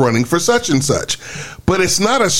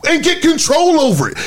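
exasperated yell; 9 semitones higher than usual. Retold or imitated. Arguing with his own people, high exasperated yell. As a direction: plead in frustration, half shouting. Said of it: "And I'm like".

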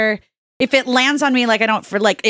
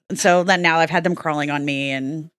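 ordinary talk, nothing marked

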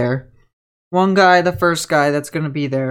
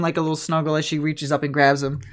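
normal talking voice

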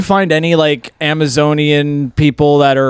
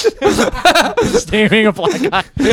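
laughing; 7 semitones higher, slower than usual. Real, not acted. Laughing through line.

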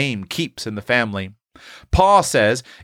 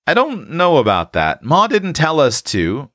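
higher-pitched, mildly hesitant objection; cautious; 7 semitones higher than usual. A narrator's reading. Eph objecting to using the best room, cautious. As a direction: voice a boy wary of breaking house rules. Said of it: "began cautious Eph".

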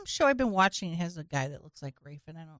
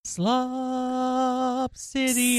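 very slow; 5 semitones higher, much slower than usual. Pretend. Drawn-out joke voice.

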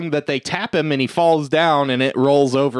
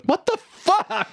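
baffled disbelief; slower than usual. Retold or imitated. Roger baffled by stranger.

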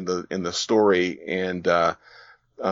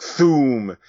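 booming sound effect; 4 semitones higher, 6 dB louder than usual. Retold or imitated. Narrating a comic panel to a podcast host, a loud mock thoom. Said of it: "the sound effect".

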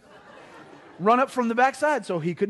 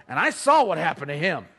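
normal talking voice

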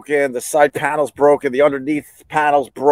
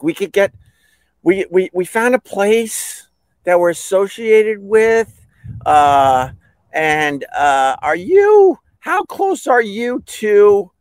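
higher, drawn-out searching delivery; insurance rep on phone; 8 semitones higher, slower than usual. Retold or imitated. Insurance rep telling caller about a shop, hesitant. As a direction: imitate a rep hunting for a place, haltingly.

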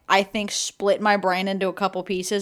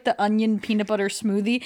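ordinary talk

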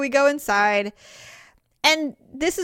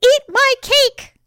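slow, high, loud hammed-up command; slow, much higher, louder mock demand; mock pushy grandma; 12 semitones higher, 8 dB louder, slower than usual. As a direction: ham up a pushy grandma forcing cake. Pretend pushy grandparent feeding guests.

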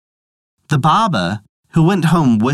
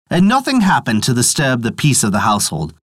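ordinary delivery

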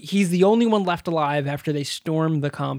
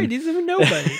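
laughing; 9 semitones higher, faster than usual. Real, not acted. Laughs agreeing.

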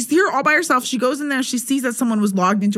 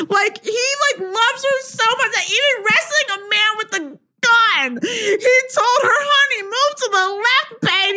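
swooning excitement; 10 semitones higher than usual. Real, not acted. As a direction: squeal with delight at how sweet he is. Gushing over romantic book scene.

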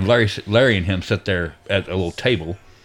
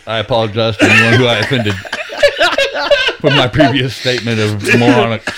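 mock formal apology; 6 dB louder than usual. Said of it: "how am I supposed to do this?".

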